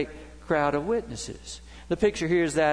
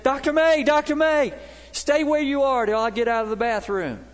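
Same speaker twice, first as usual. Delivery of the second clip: loud high call across the room, then still loud; shouting across a store; 10 semitones higher, 6 dB louder than usual. Retold or imitated. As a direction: call out to the pastor from the restroom door. Man calling across the store.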